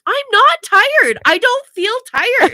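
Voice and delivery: loud, high, drawn-out mock wail; tearful protest. Crying wife denying she's tired, mock tearful protest. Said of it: "as I'm crying".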